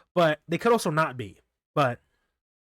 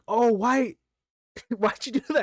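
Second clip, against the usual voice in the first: shocked; 9 semitones higher, 3 dB louder than usual. Retold or imitated. Everyone shocked at the zap.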